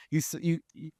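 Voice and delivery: usual speaking voice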